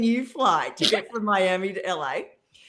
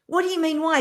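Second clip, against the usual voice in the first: incredulous; 7 semitones higher, faster than usual. Retold or imitated. Retold disbelief, high.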